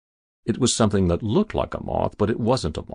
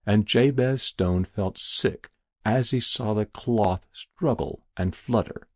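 usual speaking voice

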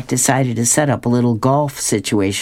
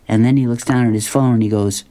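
ordinary talk

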